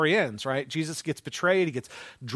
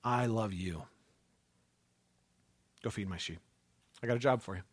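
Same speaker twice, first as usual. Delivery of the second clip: tender Jesus; 6 semitones lower, 7 dB quieter than usual. Retold or imitated.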